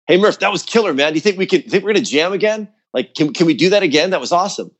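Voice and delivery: high, eager mock-excited voice; imitating a hyped bandmate after a show. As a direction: gush like a fired-up bandmate.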